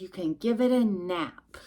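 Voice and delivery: ordinary delivery, nothing marked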